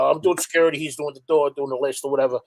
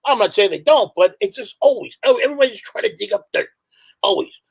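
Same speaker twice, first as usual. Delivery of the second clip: usual speaking voice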